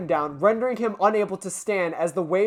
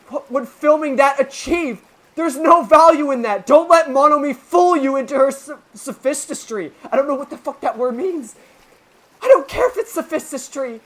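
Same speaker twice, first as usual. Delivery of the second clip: heated argument; 7 semitones higher, 8 dB louder than usual. Acted. Yagumo arguing with Hitoa.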